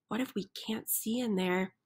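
soft, fast, hushed worry; fearful hush. Acted. Chicken frets to friends about the dark cave, hushed and quick. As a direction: rush it out under your breath.

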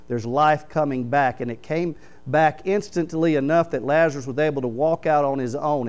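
ordinary talk

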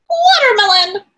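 loud high toucan voice; big beaked toucan. Acted. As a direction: squawk it like a bossy toucan. Toucan answers.

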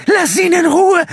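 very high, loud, slow shriek. A narrator's reading. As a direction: shriek at him to let go. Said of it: "kreischte Gina".

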